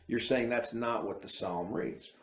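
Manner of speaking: ordinary talk, nothing marked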